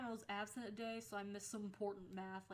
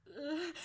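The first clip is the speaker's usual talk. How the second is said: high, loud "ugh" of dread; exasperated groan; 7 semitones higher, 7 dB louder than usual. Retold or imitated. Student stuck on a math problem, groaning.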